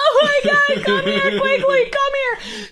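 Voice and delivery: high, loud, panicky mock wail; imitating crying girl. Girl crying for her friend in the haunted house, high and panicked. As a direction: play the terrified girl begging her friend to come. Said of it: "She starts crying".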